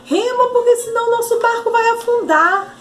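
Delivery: high-pitched urgent plea to row; desperate cry. Acted. João begs Pedro to row before they sink, desperate. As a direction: call out in a desperate, storytelling panic. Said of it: "começou o desespero".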